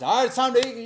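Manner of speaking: calling out loud and high to the house; hollering down. Retold or imitated. Peter hollering down for his lunch, loud call. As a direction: holler it down the stairs. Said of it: "He hollers".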